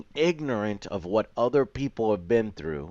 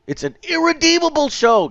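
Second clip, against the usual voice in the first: much higher, louder sarcastic mock; 7 dB louder than usual. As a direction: parody the commenter's melodrama. Mocking a hostile comment.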